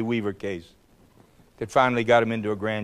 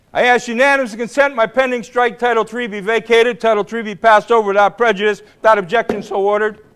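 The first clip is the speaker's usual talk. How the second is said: parliamentary motion; 9 semitones higher, 7 dB louder than usual. Retold or imitated. Reciting the floor motion.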